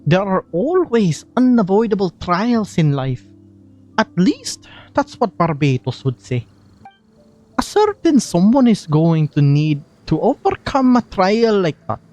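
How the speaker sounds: lightly Scottish Venti voice, playful; a gentle Scottish lilt as the bard; Scottish accent. Acted. Streamer voicing Venti's lines, in a light Scottish accent. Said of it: "Scottish Venti".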